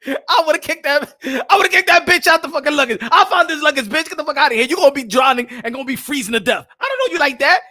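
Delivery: loud, high comic outrage; mock-furious rant. Man kicking her off the luggage, loud mock fury. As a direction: yell her off the luggage, hammed-up. Acted quarrel over floating luggage.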